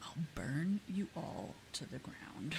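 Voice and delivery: slow, mock-sinister whispered threat; menacing whisper. Answering annoying game customers, mock threatening whisper. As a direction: hiss it like a cartoon villain. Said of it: "And I'm like".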